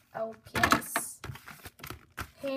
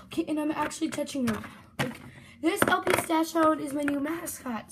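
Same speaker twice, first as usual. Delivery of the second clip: ordinary talk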